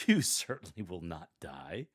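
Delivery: a sly laugh on "You"; mocking chuckle. Shown as a demonstration. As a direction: chuckle through it, mocking the idea. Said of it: "chuckle when you say".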